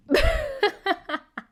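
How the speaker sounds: high-pitched laughing fit; burst of laughter. Real, not acted. Cracks up at the name.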